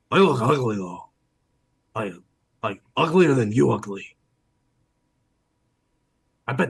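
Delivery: blunt, nosy gull character voice; cheeky hungry seagull. Acted. Seagull reporting on who's over there, cheeky bird voice. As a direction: insult them, then angle for a taste. Game master plays the seagull.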